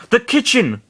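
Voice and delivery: high, startled exclamation; gasped. A narrator's reading. Master Hand seeing the burning kitchen, high gasp. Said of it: "and gasped".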